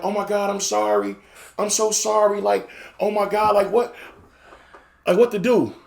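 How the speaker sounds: a lightly voiced panicked girlfriend; mock panic. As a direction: play her panicking and sorry. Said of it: "now she's in a panic".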